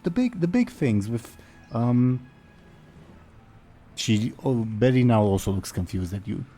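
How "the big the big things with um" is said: flustered noblewoman. Acted. Noblewoman struggling to explain, high and flustered. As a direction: fumble for the word up high. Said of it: "Betty explaining again".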